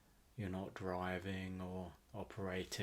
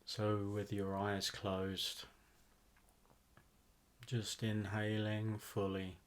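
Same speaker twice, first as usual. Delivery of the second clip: guided meditation; 3 dB quieter than usual. Acted. Host guiding listeners to relax into a rainforest visualization, guided meditation. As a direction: ease them into relaxation, phrase by unhurried phrase.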